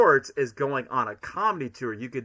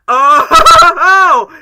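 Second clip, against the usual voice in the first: much higher, much louder Sonic yelp; movie Sonic; 20 dB louder than usual. Shown as a demonstration. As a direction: nail the cocky hedgehog's yelp. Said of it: "make my best movie Sonic impression".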